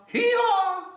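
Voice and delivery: very high, loud hee-haw bray; loud donkey bray. Shown as a demonstration. Leading children to bray loud together. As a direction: bray it out as loud as you can. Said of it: "say it real loud now".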